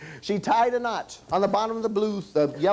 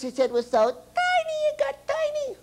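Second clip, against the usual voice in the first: tiny-head voice. Acted. Tiny-headed Rudy talking to the kids, squeaky high. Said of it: "he talked like this".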